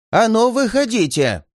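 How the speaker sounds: put-on high, trembling old man's shout; quavering elderly voice calling out; shaky old voice. A narrator's reading. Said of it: "Раздался трясущийся старческий голос".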